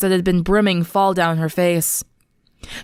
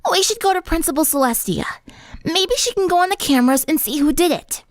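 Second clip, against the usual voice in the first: much higher, frowning, determined; earnest tomboy; 8 semitones higher than usual. Acted. Rainbow Dash urges friends to see the principal, determined. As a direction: push for going to the principal. Dash's voice held.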